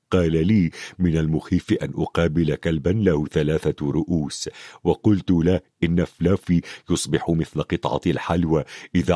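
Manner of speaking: low-pitched storytelling voice; Hagrid, rambling recollection. A narrator's reading. Hagrid recounts his tavern talk.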